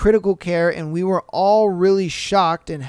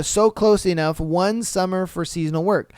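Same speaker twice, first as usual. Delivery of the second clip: normal talking voice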